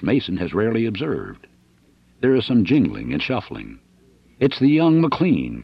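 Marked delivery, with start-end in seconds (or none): 4.4-5.6 louder, slow calling cry. Acted. Dixon outside in the rain, crying out. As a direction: call it out into the rain. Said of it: "cries Dixon".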